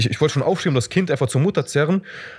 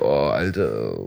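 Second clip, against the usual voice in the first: lower, drawn-out disgusted "Oh, Alter"; disgusted groan; 11 semitones lower, much slower than usual. Retold or imitated. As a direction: recoil from the Schlager music. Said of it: "Ich so". (angeekelt, tief und gedehnt)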